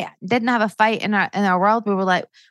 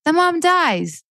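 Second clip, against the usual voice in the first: much higher, impassioned rant; 9 semitones higher than usual. Real, not acted. Ranting to the host about Disney mums, impassioned. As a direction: let the indignation run away with you. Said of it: "Sorry, I'm ranting".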